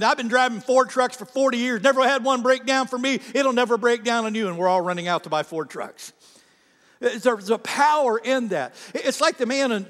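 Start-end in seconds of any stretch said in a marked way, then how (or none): 0.0-4.5 folksy farmer. Retold or imitated. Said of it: "he's going".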